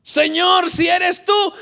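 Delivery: high, loud eager call; Pedro. Retold or imitated. Bold disciple calls to Jesus on the water, high and loud. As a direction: call up loudly to the Lord. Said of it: "mi hermano Pedro".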